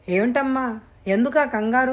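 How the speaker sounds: ordinary delivery, nothing marked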